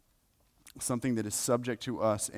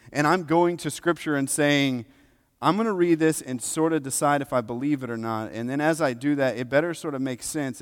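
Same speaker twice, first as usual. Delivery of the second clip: loud, raised, driving preacher delivery; impassioned preaching; 5 semitones higher, 10 dB louder than usual. Real, not acted. Preacher to congregation on coming to Scripture in faith, impassioned preaching. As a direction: preach it with force, pitch up and loud.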